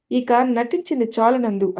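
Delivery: ordinary delivery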